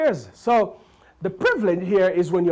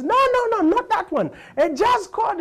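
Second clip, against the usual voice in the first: high, loud insistence; insistent correction; 5 dB louder than usual. Retold or imitated. Man corrects Stevie.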